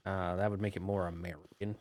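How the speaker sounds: normal talking voice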